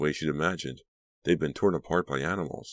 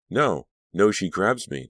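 raised, urgent cry; 4 semitones higher, 5 dB louder, slower than usual. A narrator's reading. Patient relives a fight with her rival, crying out. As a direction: cry out in mild alarm, a little louder and higher. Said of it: "Danielle cried".

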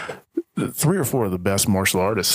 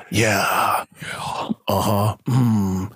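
terse, drawn-out action-hero grunts; mock a man of very few words; John Wick one-liners; much slower than usual. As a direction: imitate a laconic movie hero. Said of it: "even John's one lines are the most poignant".

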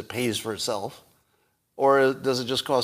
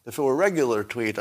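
ordinary talk, nothing marked

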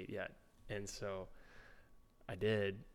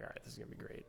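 whispered; 15 dB quieter, much faster than usual. Retold or imitated. Rookie's nervous inner sarcasm.

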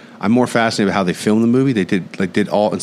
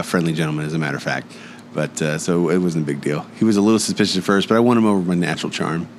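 ordinary talk, nothing marked

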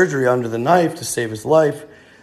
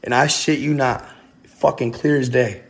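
usual speaking voice

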